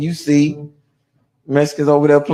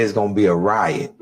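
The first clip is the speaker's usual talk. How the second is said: low, matter-of-fact calm; calm about riot; 9 semitones lower than usual. Retold or imitated. Old inmate explaining the warning, calm and low. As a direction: break the news unbothered, in a low voice. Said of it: "You pretty calm about a riot".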